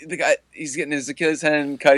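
ordinary talk

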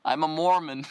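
ordinary talk

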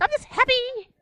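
very high mock-blissful chirp; Mary. Imitating the always-happy friend, blissfully high. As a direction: beam it out in a giddy little voice. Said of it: "She's just like".

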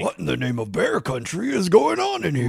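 big blustery bear dad; Papa Bear voice. Retold or imitated. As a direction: bellow like a cartoon papa bear. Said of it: "who does a Papa Bear voice".

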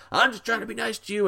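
put-on high, fast drunk voice; drunk man. Drunk man repeats himself to the kid, high and quick. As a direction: plead like a tipsy stranger. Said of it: "And he's like".

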